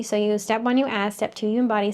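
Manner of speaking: ordinary delivery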